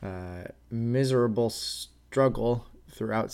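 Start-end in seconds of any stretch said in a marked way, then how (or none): none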